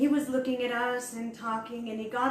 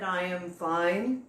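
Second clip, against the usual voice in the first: slow low mock reply; 4 semitones lower, much slower than usual. Gurudev voiced playing phone.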